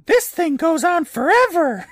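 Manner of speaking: very high, loud hammed-up wail; mock scream. Eddie running down the tunnel, high mock scream. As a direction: scream it high like a whiny kid, exaggerated. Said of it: "and Eddie screams".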